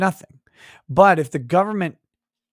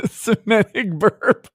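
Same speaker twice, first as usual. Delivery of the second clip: laughing; 7 semitones higher, slower than usual. Real, not acted. Laughing at chat joke.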